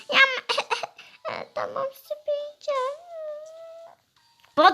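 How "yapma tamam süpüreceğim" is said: high giggling through the words; laughing. Real, not acted. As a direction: break up laughing mid-scene. Playful threat dissolving in laughter.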